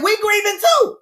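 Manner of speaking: high, loud sassy sister voice; sister's retort. Retold or imitated. Sister shutting down the grief doc, loud and sassy. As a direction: snap it back like a bold sister. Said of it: "My sister said".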